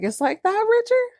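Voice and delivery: high incredulous question; stunned Pam. Retold or imitated. Pam to Richard, betrayed, sharply rising. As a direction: rise in shocked disbelief. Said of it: "She's like".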